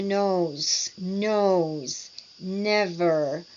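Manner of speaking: "nose, nose, never" with drawn-out, vibrating N; over-articulate "nose" and "never", extending the N; over-articulated N. Shown as a demonstration. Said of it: "you are over-articulating for all of them".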